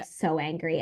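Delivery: ordinary talk, nothing marked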